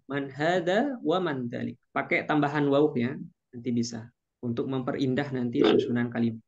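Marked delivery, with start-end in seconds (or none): none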